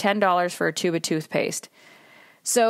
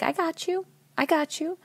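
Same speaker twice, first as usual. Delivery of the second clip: soft, higher-pitched gentle reassurance; holding space; 8 semitones higher, 4 dB quieter than usual. Acted. Coach voicing someone holding space for another, soft reassurance. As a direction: reassure them softly, lightly lifted voice.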